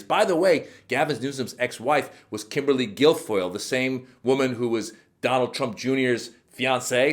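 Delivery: normal talking voice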